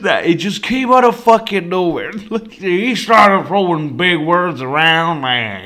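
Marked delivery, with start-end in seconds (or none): none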